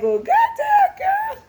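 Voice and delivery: high, loud mock shout of disbelief; shocked shout. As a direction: yelp it up high like you can't believe her. Driver reacts to jealous passenger.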